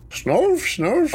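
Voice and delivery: squeaky high cartoon snarf; cartoon creature voice. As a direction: chirp it like a cartoon pet. Imitates Thundercats sidekick.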